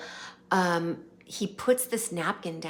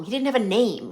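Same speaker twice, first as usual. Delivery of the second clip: ordinary talk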